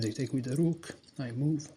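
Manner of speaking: usual speaking voice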